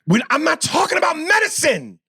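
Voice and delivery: high-pitched heated protest; real frustrated objection; exasperated outburst. Real, not acted. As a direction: cut off the analogy, fed up. Rejects co-host's analogy.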